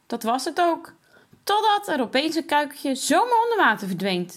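put-on upset boy telling mum; angry and sad. A narrator's reading. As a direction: complain with hurt anger. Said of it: "zegt Jasper boos en verdrietig tegelijk".

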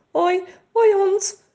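slow, high, nervous stammer. A narrator's reading. Frightened girl greeting a big shepherd dog, slow nervous stammer. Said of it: "stamelt ze".

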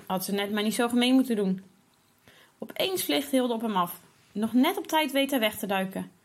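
ordinary delivery

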